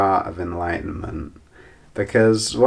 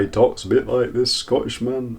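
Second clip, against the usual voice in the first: a terrible put-on Scottish accent, pitched higher; bad Scottish accent; 5 semitones higher than usual. Describing the rough Scottish guy from the retreat, bad Scottish accent. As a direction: mimic a rough Scottish bloke, badly. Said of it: "that's a terrible impression".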